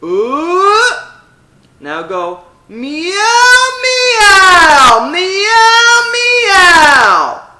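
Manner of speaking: high, loud pressed meow; belted meow with compression; compressed meow. Shown as a demonstration. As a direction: keep the pressure all the way. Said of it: "keep that feeling of pressure".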